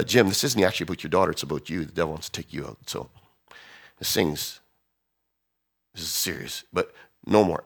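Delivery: low, soft, grave counsel; hushed prophetic warning. Retold or imitated. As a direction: confide a serious warning quietly. Prophetic person confides a warning.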